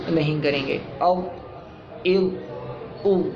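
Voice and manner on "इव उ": इव उ साफ़ अलग अदा; ظ letter sounds. Shown as a demonstration. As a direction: articulate each letter sound slowly. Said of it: "जैसे मैंने मश्क में बताया". (ज़ौ की आवाज़ धीरे और साफ़)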